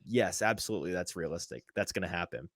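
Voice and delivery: low, quiet, deadpan machine reply; deadpan machine's verdict. Acted. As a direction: play the machine agreeing, dry and understated. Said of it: "And the machine said".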